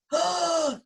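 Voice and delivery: shocked gasp. Acted. Shocked donut gasps.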